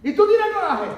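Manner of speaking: pregunta aguda y fuerte, en broma; pregunta burlona. Acted. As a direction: pincha al niño caprichoso. Imitando la pregunta de Dios. (Dios pregunta al niño Jonás, en broma, alto)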